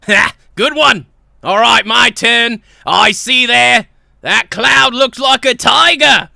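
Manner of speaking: loud, hearty, oblivious boss; Saxton Hale. Acted. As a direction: boom it out with jolly confidence. Boss plays cloud game.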